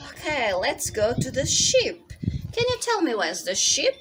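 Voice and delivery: ordinary talk, nothing marked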